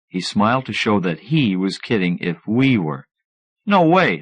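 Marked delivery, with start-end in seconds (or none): 3.6-4.2 sharp, louder no way; snapped. A narrator's reading. Narrator snapping at a kid who wants wishes. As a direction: snap it back at the boy. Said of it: "I snapped".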